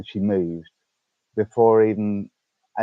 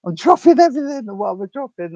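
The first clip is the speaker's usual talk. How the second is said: much higher and louder, dropping things; higher, louder panicked repeat; flustered exclaim; 8 dB louder than usual. Real, not acted. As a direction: yelp it out in a fluster. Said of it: "Oops, I'm dropping everything".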